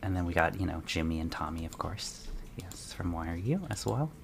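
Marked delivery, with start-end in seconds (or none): 1.7-4.1 breathy secret whisper. Real, not acted. Fan whispers an aside about the couple.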